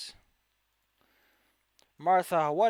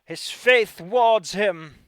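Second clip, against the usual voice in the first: loud, slow, louder priestly boom; booming cleric voice; 5 semitones higher, 10 dB louder, much slower than usual. Acted. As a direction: proclaim it like a zealot.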